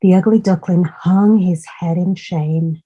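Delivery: ordinary delivery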